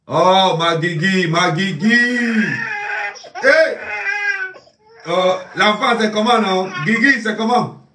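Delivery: high, loud, gushing hello; light up at her call; excited greeting. Acted. Greeting Guigui on the phone, high and loud with excitement.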